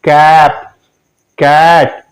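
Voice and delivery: कैप, कैट with a short open "a". Shown as a demonstration. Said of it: "तो वो short sound होगा". (छोटा ऐ)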